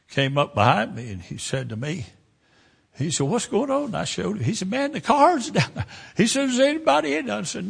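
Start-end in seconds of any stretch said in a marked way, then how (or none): 3.3-3.9 high, surprised question; a stranger pulling up and asking; curious passer-by. Retold or imitated. Passing man asks the preacher what happened, high and surprised. 4.7-7.3 high, louder, startled; alarmed stranger. Retold or imitated. Stranger sees the car down the bank and asks in alarm. Man sees the wreck below.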